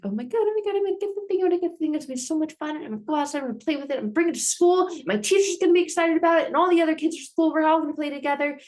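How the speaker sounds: high, loud and fast, bursting with excitement; excited kid. Retold or imitated. Sleepless kid on Christmas Eve, high loud thrilled child voice. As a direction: squeal like a kid the night before Christmas. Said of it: "and you're like".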